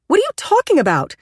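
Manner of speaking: high, loud angry accusation; angry confrontation. Acted. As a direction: confront him about her daughter. Angry mother confronts him.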